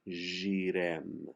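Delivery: "Girem" with a very soft G, like "zhee-rem". Shown as a demonstration. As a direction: make the G in "Girem" soft, almost Portuguese. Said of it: "is a very soft G".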